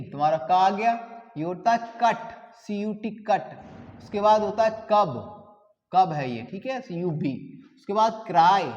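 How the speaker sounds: normal talking voice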